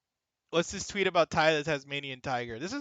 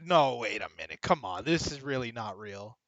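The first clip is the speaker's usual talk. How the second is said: lower, mock-stunned inner voice; inner disbelief; 6 semitones lower, 3 dB quieter than usual. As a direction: quote your own shocked thoughts low. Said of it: "I'm like".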